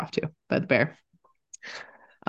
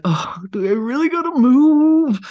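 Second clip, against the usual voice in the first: high, slow, unbothered mock bear; lazy bear voice; 11 semitones higher, 4 dB louder, slower than usual. Mocking bears to a friend, high and slow. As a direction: play a bear who can't be bothered to move. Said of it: "Bears will be like".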